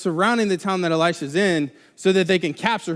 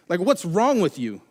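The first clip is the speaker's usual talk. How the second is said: ordinary delivery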